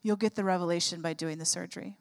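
soft, low, voiced hush; retold quiet whisper. The Lord answering her prayer, retold in a quiet low voice. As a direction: quietly imitate God speaking to the heart. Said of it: "he just whispers quietly to my heart".

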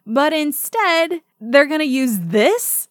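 incredulous. Real, not acted. Incredulous rant about set choice.

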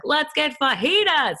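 high, bright burst of craving; excited squeal. Retold or imitated. To her husband, craving fajitas, an excited high squeal. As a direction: squeal it like a kid wanting treats.

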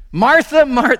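high, loud, lovestruck calling of the name; old dad's romantic call. Retold or imitated. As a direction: belt it out like an old man in love. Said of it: "I could just see him going like".

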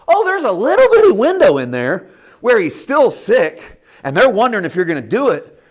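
high, loud, pressing preacher's call; preaching at full force. Acted. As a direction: push the argument hard, rising into a shout. Preacher voicing the tempting argument.

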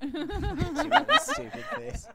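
squealing with laughter, high and slow; laughing. Real, not acted. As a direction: laugh the words out. Teasing a character, laughing.

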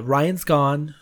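normal talking voice

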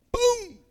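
"Boom!" yelped high; a sudden high punch sound; playful sound-effect yelp; mock boom. Imitating a punch or beat.

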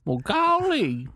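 high, slow Appalachian drawl; appalachian accent. Retold or imitated. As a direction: drawl it like an old Appalachian prospector. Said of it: "talk in my natural hillbilly voice".

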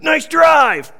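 called out high and loud across the tee; loud compliment. Retold or imitated. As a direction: shout it like a buddy admiring the drive. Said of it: "somebody turns to me and goes".

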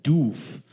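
Doof with a long, drawn-out oo; mock-umlaut pronunciation. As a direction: stretch DOOF like a pretentious foreign brand. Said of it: "with a little umlaut over the name".

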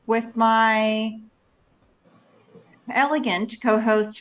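long hesitant pause before "elegant"; dramatic pause. Real, not acted. Host introducing co-host, searching for a word, dramatic pause before "elegant". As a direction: stop after "with my", then land on "elegant". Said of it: "there was even a dramatic pause before elegant".

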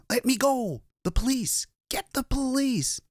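high-pitched, panicked shouting; desperate high yelling; frantic cry for help. A narrator's reading. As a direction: cry out while fighting to break free. Struggling, screaming for police.

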